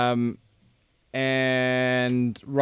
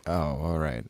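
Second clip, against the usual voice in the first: soft, resigned "oh, all right"; deflated mumble; 8 semitones lower, 11 dB quieter than usual. Retold or imitated. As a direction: let it sag in defeat. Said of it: "he's like".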